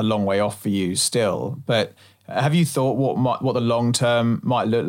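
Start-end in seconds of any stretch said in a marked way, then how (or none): none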